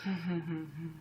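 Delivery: soft, smug closed-mouth chuckle; villainous chuckle. Acted. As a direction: chuckle quietly to yourself, gloating. Villain gloating on the throne.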